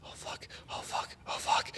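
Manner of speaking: whispered, panicked oh fucks; terrified whisper. Retold or imitated. Man clutching the drumming monkey, whispering in fear. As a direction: breathe it out in hushed terror. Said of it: "He's like".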